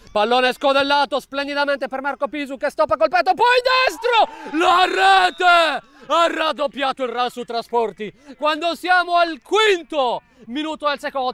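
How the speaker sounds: shouted goal commentary. Real, not acted. Second goal scored.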